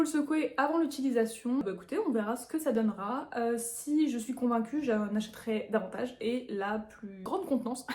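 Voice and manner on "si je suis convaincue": ordinary talk, nothing marked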